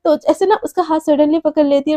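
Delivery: ordinary delivery, nothing marked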